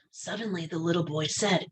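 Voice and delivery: usual speaking voice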